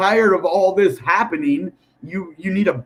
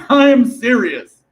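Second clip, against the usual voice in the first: laughing; 8 semitones higher, 5 dB louder than usual. Real, not acted. Laughing insists after joke.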